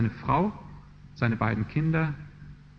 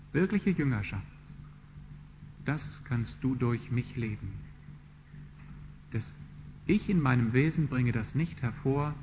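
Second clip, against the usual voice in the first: quiet prayer; 6 dB quieter than usual. Acted. Preacher models a quiet prayer of surrender.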